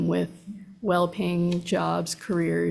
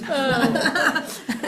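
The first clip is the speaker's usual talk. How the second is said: high nervous laugh; 7 semitones higher, 3 dB louder than usual. Real, not acted. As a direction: let out a nervous giggle. Nervous witness laughs at chair's joke.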